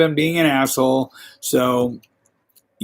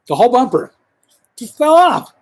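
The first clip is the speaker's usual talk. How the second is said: high, loud disbelief; can't believe it happened; incredulous; 10 semitones higher, 5 dB louder than usual. Real, not acted. Disbelief at bumper falling off.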